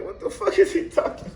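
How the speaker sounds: baffled. Retold or imitated. Confused inner reaction.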